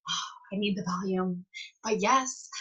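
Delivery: soft, grudging retold concession; mild annoyance. Seller reluctantly letting a customer switch, mildly annoyed. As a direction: give in quietly with a touch of annoyance. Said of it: "I was so annoyed".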